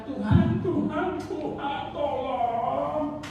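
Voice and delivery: high, pleading cry to God, fading off; mock a desperate whining prayer; whiny begging prayer. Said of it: "berdoa hari ini minta". (doa merengek yang diejek)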